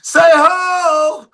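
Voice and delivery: rally the crowd with a high call; hype call to the crowd. Retold or imitated. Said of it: "I said".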